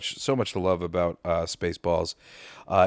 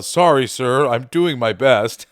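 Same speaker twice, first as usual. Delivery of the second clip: loud quoted apology; 8 dB louder than usual. Acted. Quotes the gunner apologizing to commander.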